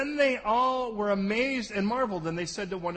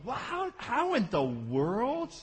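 mock amazement; 4 semitones higher, much slower than usual. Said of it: "and you're going".

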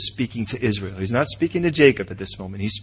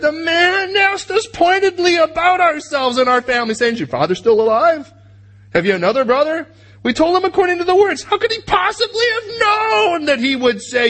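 brothers voiced; 13 semitones higher than usual. Brothers voiced in Bible story.

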